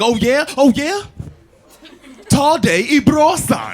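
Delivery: hammed-up comeback, drawn out at the end; mock argument comeback. Husband firing back in a row with his wife, mock angry. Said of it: "Got into an argument with my wife".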